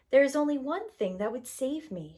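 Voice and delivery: ordinary delivery, nothing marked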